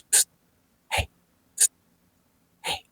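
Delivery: short, whispered psst and hey; hushed call for attention. Retold or imitated. Friend behind trying to get her attention, hushed call. Friend secretly calling narrator.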